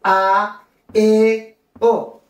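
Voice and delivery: "A, E, O" one at a time, high and ringing; strong vowels. Shown as a demonstration. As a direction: sound out "A, E, O" as pure, resonant Spanish vowels. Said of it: "ça résonne très fort". (« A, E, O » détachés, forts et sonores)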